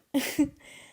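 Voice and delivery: sudden high laugh; a delighted laugh; bright laugh. Real, not acted. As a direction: burst out laughing. Laughs about pronunciation.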